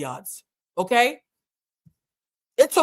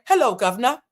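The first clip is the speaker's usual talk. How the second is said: higher-pitched mock British greeting; bad British accent; 4 semitones higher, 4 dB louder than usual. Joking to British supporters, in a bad British accent. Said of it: "that's not a good accent".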